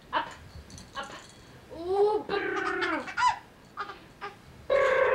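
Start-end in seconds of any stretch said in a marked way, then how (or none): 1.8-5.1 loud playful lip-trill brrr. Pretend. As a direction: blow a loud raspberry brrr. Mother making noise for baby.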